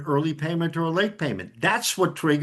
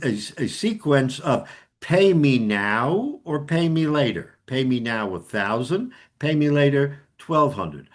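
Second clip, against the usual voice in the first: ordinary talk, nothing marked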